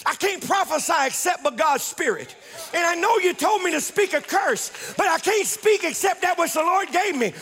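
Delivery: high-pitched, insistent self-defence; emphatic protest. Acted. Prophet explaining to Balak why he cannot curse, emphatic.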